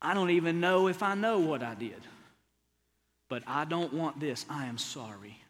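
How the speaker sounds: tender apology. Shown as a demonstration. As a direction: apologise gently to your wife. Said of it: "Go to your wife and say".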